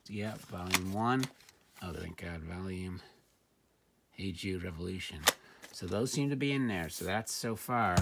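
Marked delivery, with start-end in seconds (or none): none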